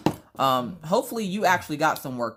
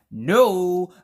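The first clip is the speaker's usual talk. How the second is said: loud, raised theatrical protest; dramatic denial; 4 semitones higher, 8 dB louder than usual. Retold or imitated. Mom denying to her son she's dramatic, theatrical protest. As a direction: deny it with mock outrage.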